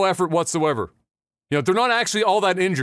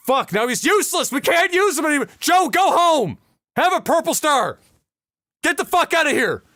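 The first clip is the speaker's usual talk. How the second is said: raised-pitch frustrated order; exasperated dismissal; 10 semitones higher, 3 dB louder than usual. Acted. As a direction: send a useless soldier packing. Platoon leader dismisses traumatised Joe.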